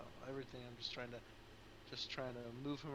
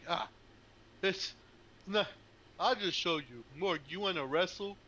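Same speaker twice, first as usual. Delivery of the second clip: eager challenge; 5 semitones higher, 9 dB louder than usual. Acted. Half-giant calls friend to wrestle.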